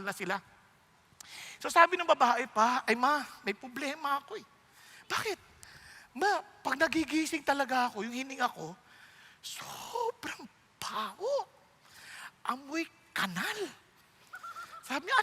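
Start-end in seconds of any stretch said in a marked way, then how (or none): 2.8-8.7 quiet confiding. Acted. Bride confides secret to mother. 9.4-10.4 breathy whispered word. Acted. Bride whispering her bad breath secret to her mother. 10.8-13.6 quiet confiding. Acted. Bride confides secret to mother.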